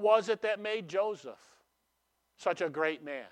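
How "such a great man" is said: ordinary talk, nothing marked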